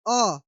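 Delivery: a quick, clipped अ; short a. Shown as a demonstration. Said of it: "अ जितना समय हम बोल रहे हैं".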